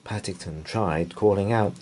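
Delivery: plain reading voice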